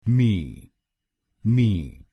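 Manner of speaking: मी said as a short "mee"; syllable demo. Shown as a demonstration. Teacher drills final syllable for students, clear demo. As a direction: say the last syllable alone, twice. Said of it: "हम बोलेंगे".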